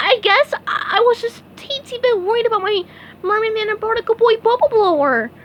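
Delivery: high, stammering cartoon sponge voice; embarrassed SpongeBob. SpongeBob caught at night apologizes to Patrick, stammering high. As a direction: fumble the excuse in a squeaky sheepish voice.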